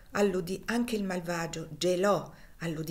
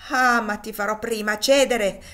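loud, furious threat; angry; 3 semitones higher, 10 dB louder than usual. A narrator's reading. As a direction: roar it at him in rage. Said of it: "rispose Legree con rabbia".

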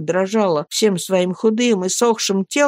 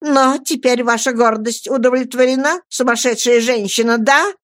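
higher, bitter accusing cry; angry shout; 6 semitones higher than usual. A narrator's reading. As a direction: fling it at the mother in fury. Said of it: "крикнула Роза".

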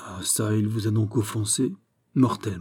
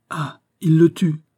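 higher, louder, drawn-out cry; exclaiming; 7 semitones higher, 6 dB louder, slower than usual. A narrator's reading. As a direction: cry out slowly as the realisation lands. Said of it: "s'écria Raoul". (s'écrier, stupéfait)

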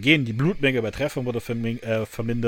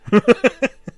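high, loud laughing burst; real laugh, high and loud; 12 semitones higher, 10 dB louder than usual. Real, not acted. Amused after quoted expletive.

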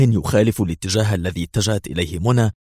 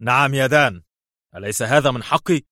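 nervous irritation; 5 semitones higher than usual. Acted. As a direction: snap back impatiently. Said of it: "أجابه أدهم في عصبية".

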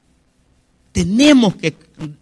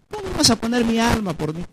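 hushed, higher-pitched question; soft reproach; 4 semitones higher, 8 dB quieter than usual. Acted. The Lord questions the absent believer, softly reproachful.

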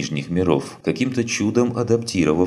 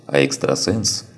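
plain reading voice

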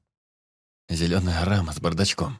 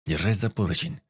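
plain reading voice

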